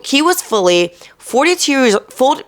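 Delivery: tripping over the words; stumbling stutter mid-sentence. Real, not acted. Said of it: "I stuttered".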